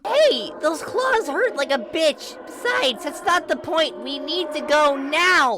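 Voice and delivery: squeaky urgent plea; high urgent voice. Acted. Basil pleading urgently.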